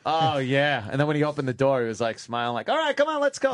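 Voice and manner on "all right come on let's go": much higher, a put-on breezy dad voice; forced cheer. Dad hiding his scolding from his son, forced cheer. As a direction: cover it up with fake cheer. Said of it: "trying to make it all better".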